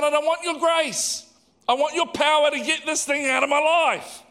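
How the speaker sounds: high-pitched, worked-up demand; Paul. Acted. Paul demanding power to be rid of the thorn, indignant. Acting Paul protesting to God.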